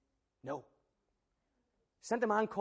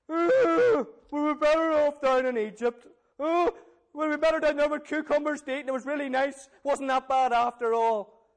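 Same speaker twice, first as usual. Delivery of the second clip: higher, louder, nasal-free whiny complaining crowd; whinging Israelites; 6 semitones higher, 5 dB louder than usual. Acted. Israelites moaning at Moses in the desert, whiny and loud. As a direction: moan like a fed-up grumbler longing for Egypt.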